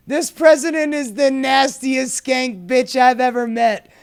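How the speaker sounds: blustering Trump voice. Acted. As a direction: read it like Trump would. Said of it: "giving us the Trump voice".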